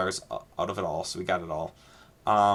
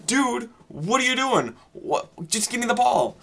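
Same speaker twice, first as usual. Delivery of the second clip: exasperated; 12 semitones higher than usual. Retold or imitated. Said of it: "And it's like".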